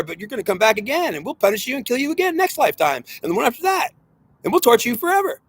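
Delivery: high, intense threatening rant; working yourself up into a menacing frenzy; a preacher swept up in his own warning, voice climbing high; carried away. Real, not acted. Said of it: "I get carried away sometimes".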